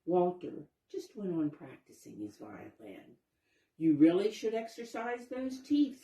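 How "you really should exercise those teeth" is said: coaxing fox. Acted. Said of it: "said Wyatt".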